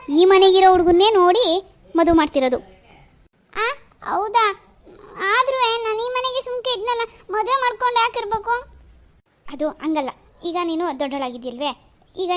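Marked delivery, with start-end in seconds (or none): 3.6-8.5 high, slow tearful question; weepy. Acted. Daughter tearfully questioning Satish. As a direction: ask through leftover tears.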